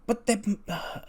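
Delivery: high, soft flustered stammer; flustered protest. Acted. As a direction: start to object in a small, high voice. Lyra's flustered retort, acted.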